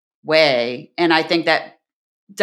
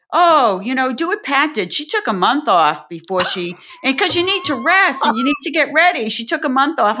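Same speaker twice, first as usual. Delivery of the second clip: lightly acted advice-giver, pitched higher; higher, insistent imitation of a well-meaning colleague; Folkman; 7 semitones higher than usual. Senior colleague telling women to copy her pregnancy leave, mock-insistent. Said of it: "Folkman, God bless him, would say".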